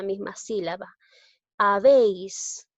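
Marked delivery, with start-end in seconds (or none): 1.6-2.7 slow example word. Shown as a demonstration. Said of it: "observo que la E es abierta".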